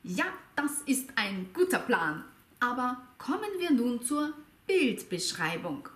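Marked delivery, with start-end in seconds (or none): none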